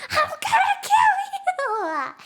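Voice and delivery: high, drawn-out shouted threat breaking into laughter; over-the-top mock-furious yell; acted outraged scream. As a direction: scream it slowly, half laughing. Repeats outburst from her seat.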